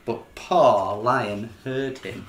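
plain reading voice

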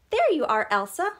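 high, loud happy exclamation; exclaimed. A narrator's reading. Anna finds Elsa, exclaiming. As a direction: burst out with a bright greeting. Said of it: "Anna exclaimed".

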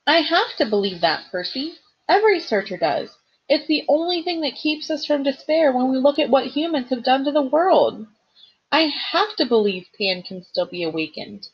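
a hint of a young satyr clinging to hope; lightly voiced determined satyr. Grover insisting to Percy he must believe.

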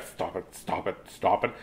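hushed imitation of a poking wife; quiet lightly imitated nagging; mock hushed scolding. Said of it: "Sometimes she's a poking hand, like".

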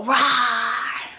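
light mock roar; a cute pretend dinosaur growl for kids; playful dinosaur rawr. Shown as a demonstration. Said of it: "Can you pretend to be a dinosaur?".

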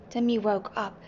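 plain reading voice

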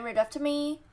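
ordinary delivery

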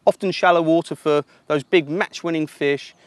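usual speaking voice

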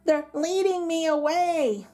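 high, slow, wailing plaint; crying out. A narrator's reading. Little colt being led away from mama, crying out. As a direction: wail it like a frightened foal. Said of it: "out little colt".

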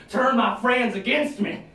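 normal talking voice

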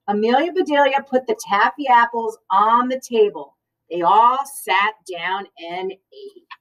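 plain reading voice

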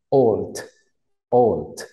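put-on "old" with a tiny T-like final D; careful English old. Shown as a demonstration. Teacher drills the word for students, "old" with a light T end. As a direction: clip the D of "old" short, tongue tapping behind teeth. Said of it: "la D de dedo es una T pero pequeñita".

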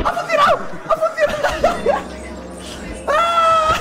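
shrieking laughter. Real, not acted. Laughing shriek after boss trick.

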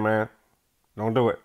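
ordinary talk

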